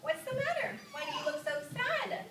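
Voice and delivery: high, soft, caring voice; mother comforting Angelina, soft and high; gentle mother. Acted. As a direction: soothe a sad child. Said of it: "Missus Mousing comes in and says".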